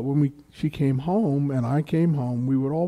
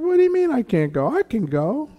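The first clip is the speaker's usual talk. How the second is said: put-on high-pitched, quick childlike protest; acted-out kid's indignation; indignant child; 11 semitones higher, 3 dB louder than usual. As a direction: argue back like an affronted five-year-old.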